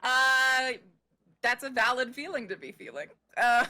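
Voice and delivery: ordinary talk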